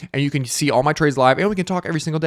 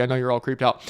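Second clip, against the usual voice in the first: ordinary talk, nothing marked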